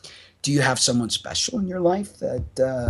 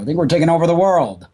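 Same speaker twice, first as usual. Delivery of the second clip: playful boast; 6 semitones higher, 6 dB louder, faster than usual. Acted.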